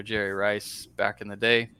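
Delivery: usual speaking voice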